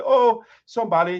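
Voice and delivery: put-on sarcastic exclamation; mock sarcasm. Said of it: "trying to be sarcastic and say".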